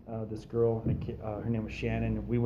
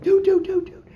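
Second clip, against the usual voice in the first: very high, loud frantic dudes; panicked friend; 9 dB louder than usual. Retold or imitated. Tanazi's friend running up terrified, high frantic yelp. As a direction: squeal it like a scared friend running up.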